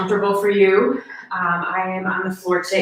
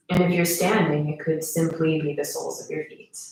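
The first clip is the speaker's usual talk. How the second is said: guided meditation; 2 semitones lower than usual. Acted. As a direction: guide them gently into stillness, unhurried.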